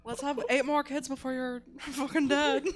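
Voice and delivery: mock Irish accent through laughter; laughing Irish accent. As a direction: push the brogue while cracking up. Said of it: "your accent's evolving".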